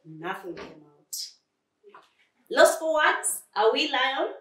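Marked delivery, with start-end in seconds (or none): 2.5-4.3 loud, high-pitched mockery; sneering. Crocodile to a speechless lion, sneering. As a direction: sneer at a rival who failed. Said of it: "sneered Crocodile".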